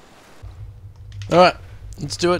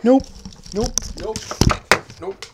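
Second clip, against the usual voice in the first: frantic high repeated nopes; panicked nopes; 11 dB louder, slower than usual. Real, not acted. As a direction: yelp nope over and over in terror. Fleeing Slender Man in a panic.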